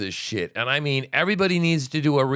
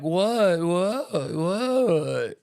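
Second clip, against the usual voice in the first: mocking feigned confusion; 7 semitones higher, much slower than usual. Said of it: "they're like".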